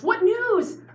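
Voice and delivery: high, loud call across the field; shouted question. Retold or imitated. David calls out to the first messenger, shouted question. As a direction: call out eagerly to a runner. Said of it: "he shouts to the first".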